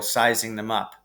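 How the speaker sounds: ordinary delivery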